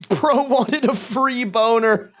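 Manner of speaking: slow words through laughter; laughing. Real, not acted. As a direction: laugh it out while talking. Laughing at chat comment.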